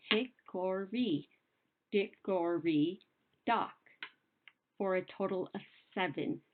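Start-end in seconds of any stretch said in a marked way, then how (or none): 0.1-3.7 Hickory, Dickory, Dock said slowly, syllables spaced out; unhurried classroom count of Hickory, Dickory, Dock. Shown as a demonstration. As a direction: count out each beat like a teacher. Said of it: "let's spell that out".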